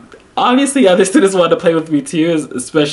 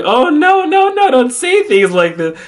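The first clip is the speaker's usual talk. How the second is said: high, retold protest; mock distressed reassurance; alarmed pleading; 14 semitones higher than usual. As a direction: plead in playful alarm, pitched way up. Retold pleading with upset student.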